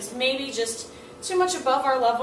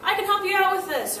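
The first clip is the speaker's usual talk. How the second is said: bright, eager classmate offer; eager offer of help; 8 semitones higher, 5 dB louder than usual. Retold or imitated. Classmate jumping in eagerly to help. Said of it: "jump in and say".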